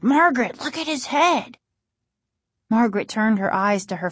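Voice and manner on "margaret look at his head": insistent. A narrator's reading. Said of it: "Casey insisted".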